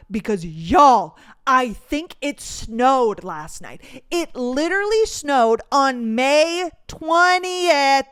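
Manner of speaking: slow, loud disbelief; incredulous. Real, not acted. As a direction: spell out the outrage slowly. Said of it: "outraged about snow".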